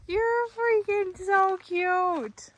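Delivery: high, slow, drawn-out gushing. Real, not acted. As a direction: melt over the cute dog. Gushing at the dog.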